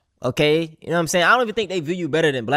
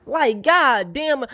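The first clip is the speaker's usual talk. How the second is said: slow, higher, drawn-out God damn; drawn-out exasperation; 10 semitones higher, much slower than usual. Real, not acted. Creator fed up with slur use, drawn-out exasperated sigh of words. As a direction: stretch it out, fed up. Exasperated plea to stop slurs.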